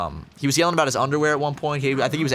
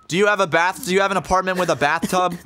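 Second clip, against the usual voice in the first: higher-pitched, mildly raised mock yell; imitating yelling weirdo; 6 semitones higher, 3 dB louder than usual. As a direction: do the pushy, ranting stranger, pitched up. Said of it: "he's yelling to himself".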